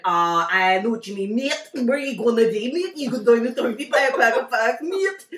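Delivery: loud mock Belfast accent; exaggerated Belfast patter. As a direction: imitate a chummy Belfast man. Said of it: "you full on Belfast accent".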